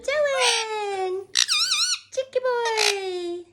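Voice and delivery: put-on pet baby talk. Owner cooing at her cheeky pet boy, sing-song baby talk.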